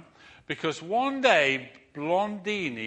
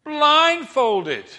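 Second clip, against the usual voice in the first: slow build to a high loud blindfolded; showman challenge; 6 semitones higher, 8 dB louder, much slower than usual. Retold or imitated. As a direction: tease the crowd, rising. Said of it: "he says".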